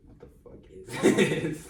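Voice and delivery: drawn-out laughing incredulity; mocking disbelief. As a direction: laugh through a teasing complaint. Mocks friend's music, laughing.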